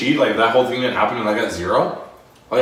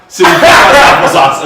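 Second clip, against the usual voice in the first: excited yell; 9 semitones higher, 16 dB louder, slower than usual. Retold or imitated. Excited dodgeball retelling.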